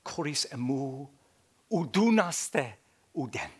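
reading the Greek words aloud. Shown as a demonstration. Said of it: "look what Christ says".

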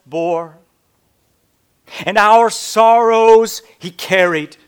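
loud, slow, ringing scripture reading; preaching at full force. Acted. Preacher booming scripture to his congregation, slow and loud. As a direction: proclaim each line to the rafters. Preacher reads Isaiah aloud.

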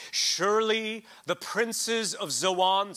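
slow, loud, high proclamation of the verse; preaching scripture. Acted. Preacher reading Isaiah's rebuke to his congregation, slow and loud. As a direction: declaim each word like a prophet.

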